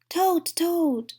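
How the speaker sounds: high, loud calls of Toad's name; shouting. A narrator's reading. Frog shouting at Toad's door to wake him. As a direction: shout up at the house to wake a friend. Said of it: "shouted Frog".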